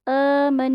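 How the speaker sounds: آمن with the آ held long and م joined to ن; recited demo. Shown as a demonstration. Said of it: "میم کو نون سے join کرنا ہے". (آمن کی تلاوت)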